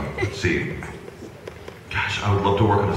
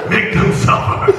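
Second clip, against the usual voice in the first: villainous Vegeta; 8 dB louder than usual. Acted. Vegeta voice to the baby.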